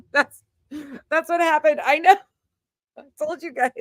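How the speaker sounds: high voice breaking into laughter; laughing helplessly. Real, not acted. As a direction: squeeze the words out through real laughter. Breaking up laughing over her hair.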